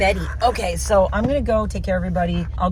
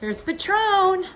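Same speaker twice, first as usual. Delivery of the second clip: higher, slow greeting to a horse; sing-song pet greeting; 12 semitones higher, slower than usual. Real, not acted. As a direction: coo hello to the horse. Greeting horse in baby talk.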